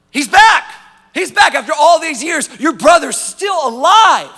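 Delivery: high, loud shouted announcement, stretched at the end; excited servant. Acted. Servant tells the older son his brother's back, excited shout. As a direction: burst out with joyful news, high and loud. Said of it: "this servant's like".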